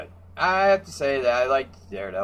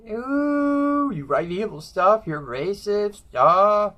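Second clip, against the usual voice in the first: high, sneering mock voice of a preachy hero; a shrill-free but high parody of a lecturing heroine; mocking scold; 8 semitones higher, slower than usual. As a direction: mimic her scolding, much higher. Mocking Jean scolding the guy.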